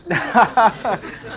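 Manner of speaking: short real laugh at his own joke; amused chuckle. Real, not acted. Preacher holding back a joke, laughing to himself. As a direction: laugh at the joke left untold. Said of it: "not gonna tell the joke".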